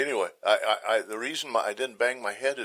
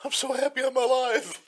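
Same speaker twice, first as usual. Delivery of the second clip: giddy relief; 12 semitones higher than usual. Retold or imitated. Relief after fall.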